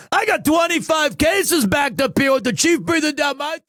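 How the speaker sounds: high put-on character voice. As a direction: play a harried game character. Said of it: "I'm using all five of my voices".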